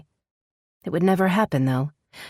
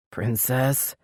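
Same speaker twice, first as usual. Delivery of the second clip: warning; 3 semitones lower than usual. A narrator's reading. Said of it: "His voice held a warning note".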